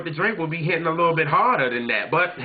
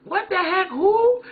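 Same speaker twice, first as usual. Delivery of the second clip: high, startled squeak of disbelief; mock shocked what the heck; shocked kid; slower than usual. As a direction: jump up in pitch like a baffled child. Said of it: "And I was like".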